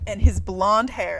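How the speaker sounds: slow, loud, emphatic quote; hammed-up book quote; loud mock reading. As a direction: read the line like a shocking reveal. Quoting the book's reveal.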